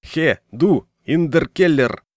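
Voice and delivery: loud gruff German sentry shout; rough shout. A narrator's reading. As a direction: bark it out like a soldier. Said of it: "грубый окрик".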